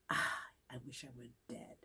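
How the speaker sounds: soft, low sigh of misery; acted sick moan. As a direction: imitate being very sick, low and faint. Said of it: "I remember also being very sick".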